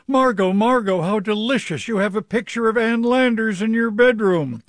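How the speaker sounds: high-pitched mock delight of a party guest; gleeful howling. Party guest howls to hostess about the bedroom picture, high gleeful howl. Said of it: "pranced out howling".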